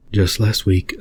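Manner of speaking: plain reading voice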